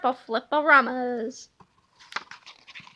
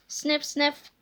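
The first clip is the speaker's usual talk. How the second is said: usual speaking voice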